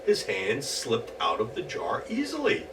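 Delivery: ordinary delivery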